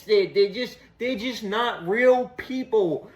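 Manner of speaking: normal talking voice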